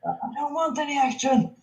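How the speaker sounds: yelled protest. Real, not acted. Co-host rejects talk of getting action, mild yelled protest. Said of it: "Don't yell at me".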